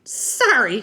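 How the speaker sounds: put-on high, loud, nervous kid; chattery high. A narrator's reading. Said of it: "his voice is chattery high".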